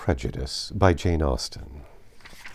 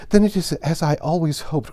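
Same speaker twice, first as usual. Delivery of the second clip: high, louder hopeful outburst; hopeful cry; 7 semitones higher, 5 dB louder than usual. A narrator's reading. Jane to her father about the letter, a hopeful cry. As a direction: blurt out the hope. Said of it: "cried Jane".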